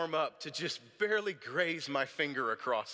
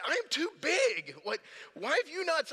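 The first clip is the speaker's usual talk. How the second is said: much higher, louder exasperated retelling; frustrated outburst; 5 dB louder than usual. Retold or imitated. Husband upset about his weight complains to wife, frustrated outburst. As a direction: replay a frustrated rant at a high pitch. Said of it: "I was, I was frustrated".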